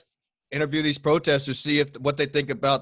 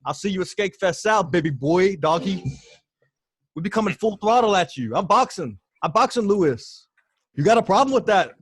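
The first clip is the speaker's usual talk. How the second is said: trash-talk call out; 3 semitones higher than usual. Acted. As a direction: hype it up like a boxing promo, taunting. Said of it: "do the official call out now".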